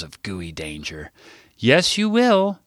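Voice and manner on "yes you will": high, slow, confident ring-back. A narrator's reading. Harper holding the narrator to paying, confident. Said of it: "Harper rang back confidently".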